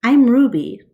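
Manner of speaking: high, loud little elephant voice; a young calf announcing herself; eager baby elephant introducing herself; excited baby elephant. Acted.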